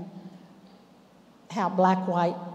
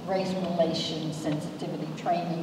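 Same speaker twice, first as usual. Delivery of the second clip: normal talking voice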